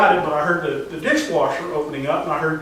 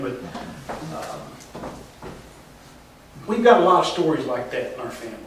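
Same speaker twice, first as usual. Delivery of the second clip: ordinary delivery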